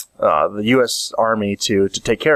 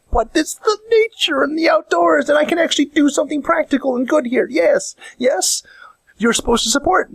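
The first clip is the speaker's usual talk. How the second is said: squeaky-high, hammed-up enthusiasm; high-pitched mock protest. Keen outdoorsman argues with a reluctant companion, high mock-earnest protest. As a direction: push the voice way up and insist.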